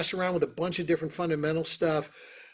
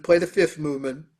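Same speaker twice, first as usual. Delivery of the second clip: ordinary talk, nothing marked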